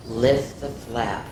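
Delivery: usual speaking voice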